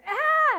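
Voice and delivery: high, loud imitated baby shriek; piercing infant cry, hammed up; baby's mock scream. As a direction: let out a baby's furious squeal. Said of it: "the baby was going".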